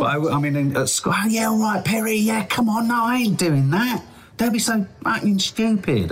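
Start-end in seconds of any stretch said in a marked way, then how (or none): 1.2-6.1 high, broad South London schoolboy voice, far from his own; rough South London accent. Retold or imitated. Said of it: "I was talking like that".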